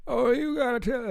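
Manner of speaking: high put-on talk-show host voice; Jesse Lee Peterson. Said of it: "I used to do a very good impression of him".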